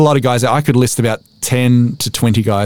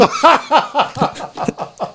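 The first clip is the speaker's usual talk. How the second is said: high laughter. Real, not acted. Guest laughs at the host's cheeky joke, high laughter.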